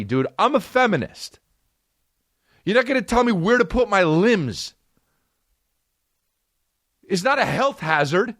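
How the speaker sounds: high, heated rant about being told what to do; indignant comic rant; worked-up rant. Real, not acted. Ranting about a rule.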